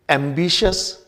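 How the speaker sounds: एम्बिशियस said as 'am-BI-shus', no i after sh; correct pronunciation. Shown as a demonstration. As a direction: end एम्बिशियस on a plain 'shus'. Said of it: "एम्बिशियस होगा". (एम्बिशियस को 'एम्बिशस' बोलना)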